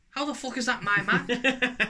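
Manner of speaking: high-pitched mock bewilderment; incredulous. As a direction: retell it in comic disbelief. Baffled retelling of a mix-up.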